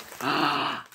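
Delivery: sudden gasp of recognition; a short touched gasp; surprised gasp. Real, not acted. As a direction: catch your breath at the sight. Sees handmade ornaments from friend.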